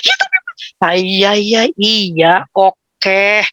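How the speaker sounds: put-on grudging kid giving in; sulky child. A child reluctantly giving in to mom.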